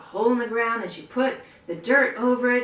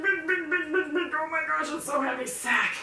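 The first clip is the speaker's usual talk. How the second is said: higher, strained and softer; straining; 5 semitones higher, 4 dB quieter than usual. Acted. Hen hauls the big sack, mock strain. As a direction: sound like lifting something too heavy.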